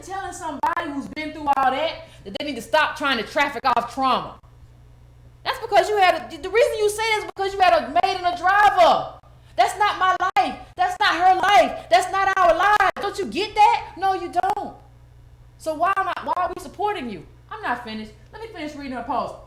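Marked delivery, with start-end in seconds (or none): 5.4-14.8 raised, higher, heated challenge; louder and higher, scolding someone directly; indignant rebuke. Real, not acted. Confronting someone over having a maid and a driver, indignant.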